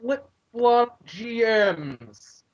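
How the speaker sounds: explaining his anger to a friend who missed it, slowly; slow and emphatic. Real, not acted. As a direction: spell out the complaint slowly. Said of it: "I missed that. What was that?".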